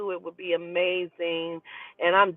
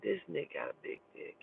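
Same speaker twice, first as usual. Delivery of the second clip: hushed aside; 6 semitones lower, 16 dB quieter, faster than usual. Retold or imitated. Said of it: "I said".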